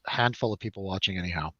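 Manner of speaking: ordinary delivery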